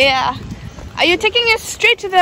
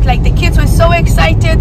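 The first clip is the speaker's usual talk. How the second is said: ordinary delivery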